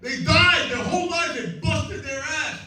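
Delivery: passionate rant. Real, not acted. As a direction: preach it like a fired-up protester. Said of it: "We got a lot of passion here".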